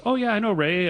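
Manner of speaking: lightly acted high-pitched eager reply; retold eager recognition. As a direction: play a caller readily recognizing a name. Retold caller readily recognizing Ray.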